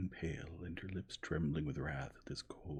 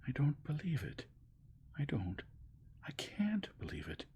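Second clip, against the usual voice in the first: anguished disbelief; 10 semitones higher than usual. A narrator's reading. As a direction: refuse it with all her heart. Dolly in disbelief.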